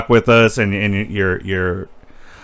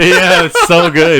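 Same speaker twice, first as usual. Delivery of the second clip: laughing; 6 semitones higher, 4 dB louder, slower than usual. Real, not acted. Reacting to golf joke.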